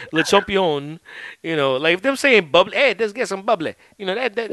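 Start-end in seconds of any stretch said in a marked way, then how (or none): 0.1-1.0 a light imitation of a Southern commentator's Le champion; lightly hammed-up commentator call; mock announcer. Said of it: "to hear them say". 2.8-3.8 a hint of a Southern good ol' boy offering drinks; Southern accent. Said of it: "you do the Southern accent".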